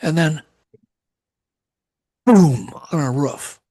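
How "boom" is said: louder, imitated crash of something landing; loud imitated boom. Telling listeners what landed on the roof, loud imitated boom. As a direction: boom it out like an impact.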